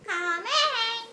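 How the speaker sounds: a wolf trying to sound gentle; big bad wolf playing granny, high and loud; mock soft snarl. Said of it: "snarled the wolf as softly".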